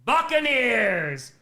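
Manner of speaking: announcer's touchdown shout; touchdown call. Real, not acted. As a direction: call the touchdown at full volume.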